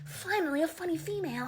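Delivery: lightly voiced delighted guy; a guy laughing at a hack joke, mildly mocking; impressed guy. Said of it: "they'll be like".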